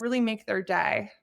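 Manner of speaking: ordinary delivery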